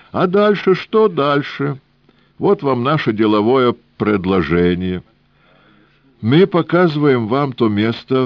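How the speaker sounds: put-on high, sing-song Yiddish-accented old man; wheedling old trader with Yiddish lilt. Rubinchik offers deal.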